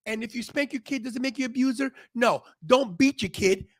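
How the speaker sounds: normal talking voice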